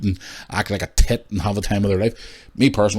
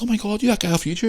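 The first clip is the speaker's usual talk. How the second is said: excited fan voice; 13 semitones higher, faster than usual. Retold or imitated. Fans recognising him.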